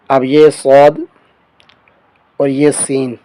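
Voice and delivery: normal talking voice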